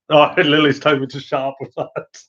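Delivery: ordinary talk, nothing marked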